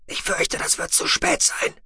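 a breathy, half-whispered alien voice; Shallowayne's voice. A narrator's reading. Shallowayne telling Tech it is too late, in a hushed alien whisper. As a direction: hold him in a hushed, husky whisper.

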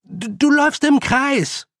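very high stammered warning; alarmed shout. Acted. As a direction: shout it after her urgently. Boy shouts warning to fleeing girl. (stotternd und alarmiert rufen)

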